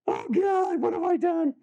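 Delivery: high, mock-panicked mom; mock horrified mom. Mother watching painter lecture her kid, in mock high panic. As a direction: shriek it out as a mother regretting everything. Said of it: "And the mom's like".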